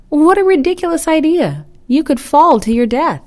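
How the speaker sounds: high, loud scoffing exclamation; alarmed disbelief. A narrator's reading. Geese warn turtle about his dangerous plan, alarmed disbelief.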